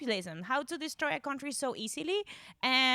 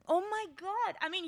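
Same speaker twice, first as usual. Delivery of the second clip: ordinary talk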